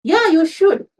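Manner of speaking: loud, high annoyed snap; irritated loud agreement; curt angry reply. Acted. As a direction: bite back, still fuming. Angry husband snaps at wife.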